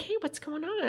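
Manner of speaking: high, soft, casual check-in; chill friend. Retold or imitated. Friend casually checking on the storyteller, imitated chill and soft. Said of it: "like, totally, like, chill".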